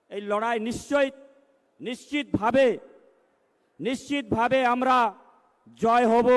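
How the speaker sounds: building to a loud rally climax; rousing loud. Real, not acted. (উত্তেজিত জোরালো ভাষণ)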